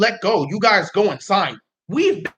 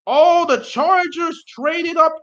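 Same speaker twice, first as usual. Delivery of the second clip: high, slow, drawn-out astonishment; astonished; 9 semitones higher, much slower than usual. Real, not acted. Streamer spots a trade on draft night, astonished. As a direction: stretch it out in real shock.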